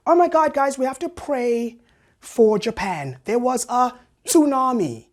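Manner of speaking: high, gushing appeal to friends; hammed-up high-pitched alarm; mock dramatic concern. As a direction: plead melodramatically, stretching the words. Mocks hypocrites who pronounce tsunami fine.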